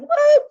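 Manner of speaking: high shrieking cheer; excited woo. Real, not acted. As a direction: let out a thrilled whoop. Cheer after announcing program.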